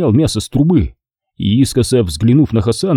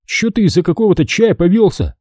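displeased; 7 semitones higher than usual. A narrator's reading. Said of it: "недовольным голосом произнёс Качан".

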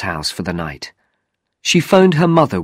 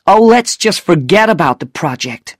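higher-pitched, irritated dismissal; angry; 7 semitones higher, 4 dB louder than usual. A narrator's reading. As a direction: give up with mild anger. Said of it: "Regan said angrily".

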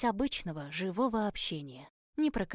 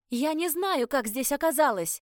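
raised, louder narrated cry; shouting over wind; 6 semitones higher, 6 dB louder than usual. A narrator's reading. Heroine shouts to the man over the wind, crying out. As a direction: call out to be heard over the wind. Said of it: "крикнула я, пытаясь перекричать ветер".

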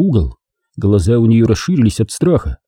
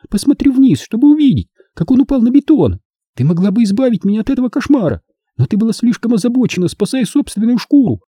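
put-on high, childlike quiet accusation; quieter childlike reproach; 13 semitones higher than usual. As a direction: reproach her like a wounded child. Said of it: "заговорила ещё тише".

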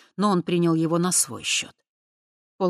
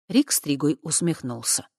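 plain reading voice

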